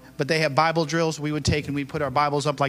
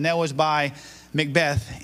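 ordinary delivery

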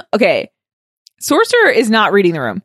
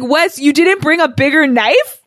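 higher, drawn-out disbelief; incredulous scolding; 6 semitones higher than usual. Retold or imitated. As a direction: chide him in mock disbelief. Mock scolding a show character.

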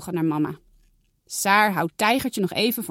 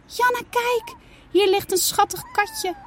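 put-on higher, eager little girl's voice; a child showing off a found kitten; excited young girl. Saar calling Janna over, excited high girl voice. Girl calls her sister.

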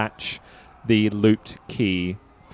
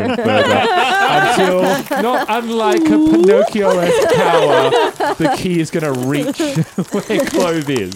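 laughing; 12 semitones higher, 7 dB louder than usual. Real, not acted. Laughing while narrating the key reach.

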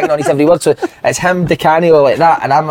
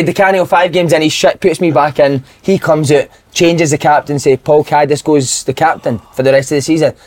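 normal talking voice

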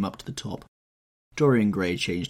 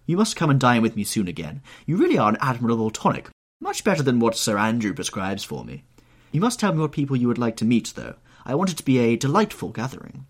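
higher, louder society matron; Lady Narborough voice; 5 semitones higher, 5 dB louder, faster than usual. Acted. Old hostess fussing over Dorian, higher and louder.

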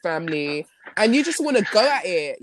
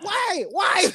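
high wailing why; mock despair; 12 semitones higher than usual. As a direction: wail it in comic anguish. Retells her dismay at the reply.

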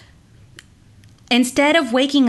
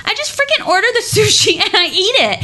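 much higher, louder, fast laughing rush; laughing excitedly; 10 semitones higher, 4 dB louder, faster than usual. Real, not acted. Telling the host she just orders sushi, laughing fast. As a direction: burst out laughing as you rattle it off.

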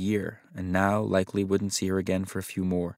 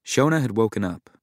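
ordinary delivery

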